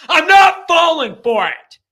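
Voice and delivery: shouted high and loud, stress on "not"; defiant shout. Real, not acted. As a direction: yell it, flat refusal, hitting "not" hard. Host refusing Jimbo's charm.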